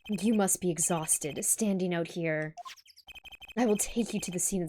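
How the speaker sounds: lightly voiced smooth-talking showman; suave low voice. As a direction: purr it like a smug charmer. Reads suave character line.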